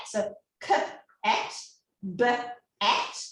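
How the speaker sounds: k, at and b, at each split into two separate sounds; segmenting sounds. Shown as a demonstration. Teacher modelling cat and bat for a child, sounds split apart. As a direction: tap out onset and rhyme slowly. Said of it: "So let's try cat and bat".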